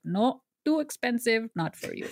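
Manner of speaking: lightly imitated East Indian mother's accent. Mom turning down daughter's pricey course, in her East Indian accent. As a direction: flip into Mom's accent for her refusal. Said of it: "I just automatically flip into her accent".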